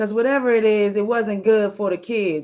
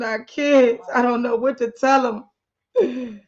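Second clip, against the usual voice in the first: distraught crying; 4 semitones higher than usual. Acted. Wife calls husband in tears, distraught and pleading. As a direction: cry out for your husband to come. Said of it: "His wife is calling him all distraught".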